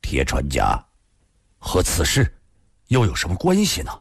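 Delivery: low, sober questioning that climbs toward the end; grave, low voice. A narrator's reading. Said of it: "沉声地说道".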